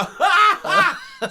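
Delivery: very high, loud mock laughter. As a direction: laugh at him like the kids did. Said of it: "And he go".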